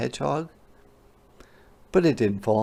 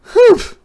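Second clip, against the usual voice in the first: sharp squeaky humph; indignant high humph; loud high huff; 14 dB louder than usual. Acted. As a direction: huff like an offended hedgehog. Hedgehog huffs.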